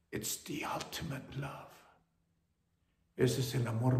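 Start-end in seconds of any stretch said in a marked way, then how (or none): 0.1-1.7 softly whispered aside. Real, not acted. Preacher whispers to the congregation about God's love. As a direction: breathe it out as a secret.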